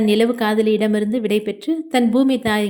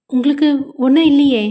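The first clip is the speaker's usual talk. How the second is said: higher, louder anxious question; a mild acted panic for her husband; panicked; 4 semitones higher, 5 dB louder than usual. A narrator's reading. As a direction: blurt it out in alarm. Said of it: "என சஹானா பதற".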